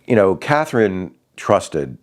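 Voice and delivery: usual speaking voice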